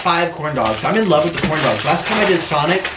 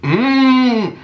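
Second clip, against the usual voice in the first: long, high, loud mmm of delight; relishing hum; 9 semitones higher, 5 dB louder than usual. Real, not acted. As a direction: hum high over a tasty bite. Relishing a bite.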